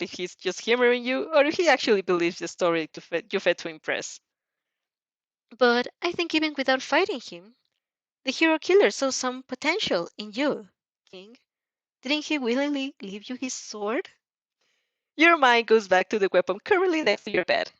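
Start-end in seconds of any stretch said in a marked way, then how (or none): 5.6-14.1 a lightly higher, polite principal's voice; Nezu voice. Acted. Nezu questioning the student over tea, lightly higher voice.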